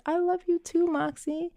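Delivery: put-on high, tender mother-to-child voice; retold tenderness.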